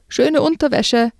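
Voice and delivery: high mocking call across the ice; taunting yell. Boy jeering at the fallen girl, high mocking yell. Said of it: "brüllte er".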